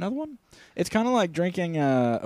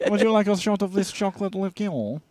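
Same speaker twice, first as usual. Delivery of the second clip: thick fake French accent, offering a drink; badly faked French accent; terrible French accent; 3 semitones higher than usual. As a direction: play a caricature French waiter. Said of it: "worst French accent of all time".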